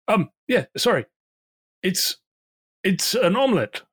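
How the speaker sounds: high, flustered call from the kitchen; flustered innkeeper. Acted.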